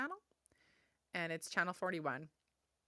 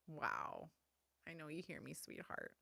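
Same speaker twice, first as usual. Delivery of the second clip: tender quiet; 9 dB quieter than usual. Retold or imitated. Mother speaking to lost daughter.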